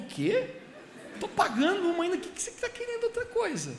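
a dad who can't believe what he just heard; high, incredulous fatherly outburst; astonished father. Retold or imitated. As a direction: pitch jumps way up in disbelief.

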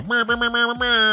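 high buzzing brr like an air horn; imitated horn honks. Asking about horns, high horn imitation. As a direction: buzz out a high horn blast. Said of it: "when they go".

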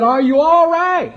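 high, slow anxious call of an alarmed husband; alarmed farmer husband calling from the bank; worried husband. Retold or imitated. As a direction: call out in alarm to your wife. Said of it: "So he said".

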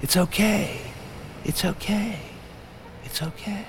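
coaxing devil voice, high and rising; sly high tempter fading out. A narrator's reading. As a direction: keep whispering temptation in their ear. Tempting voice returns.

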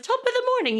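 a very high, lilting mock Irish accent. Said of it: "Irish women".

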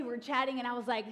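usual speaking voice

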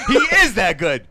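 slow, loud laughing repeat. Real, not acted. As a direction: stretch it out while laughing.

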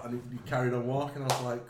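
normal talking voice

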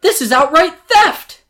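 high, loud outraged cry; choking with emotion. A narrator's reading. Robbed owner protesting to Jafar, outraged shout. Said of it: "almost choking with emotion".

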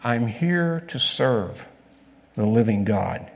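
slow, deliberate statement of faith; slow, firm answer. Shown as a demonstration. Preacher repeating the answer to the congregation, slow and firm. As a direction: lay each word down slowly. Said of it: "the answer has always been the same".

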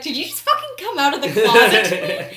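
loud, laughing mock outrage from the friend; laughing disbelief. Friend asking if she just came out, laughing and incredulous. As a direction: imitate his amused shock.

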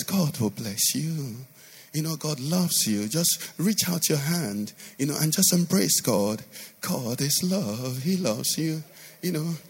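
hint of a sugary pastor reassuring the flock; mock gentle pastor. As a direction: lightly imitate a syrupy nice preacher. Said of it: "if a pastor speaks like this".

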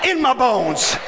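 rising, shouted preaching with long stretched words; preaching at full force. Acted. Preacher rousing his church, fiery shouted preaching. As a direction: whip the congregation into fire.

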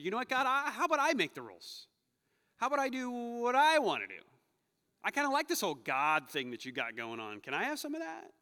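put-on sass God like a bratty kid; defiant brat. Humanity telling God it will make its own rules, defiant and cocky.

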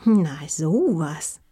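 slow, pondering drawl; slowly musing, mildly amazed; deliberate. A narrator's reading. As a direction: draw it out thoughtfully. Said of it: "sagte sie bedächtig".

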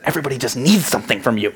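quick, exasperated aside, acted out; mock-urgent confiding to a spouse. Dad leaning over to his wife on the road trip, exasperated. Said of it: "leaned over to my wife".

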